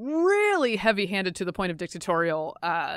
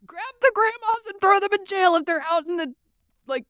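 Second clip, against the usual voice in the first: high, loud mocking voice; mocking impression; 10 semitones higher, 7 dB louder than usual. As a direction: parody her, shrill and over the top. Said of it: "She's like".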